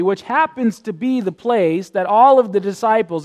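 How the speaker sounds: normal talking voice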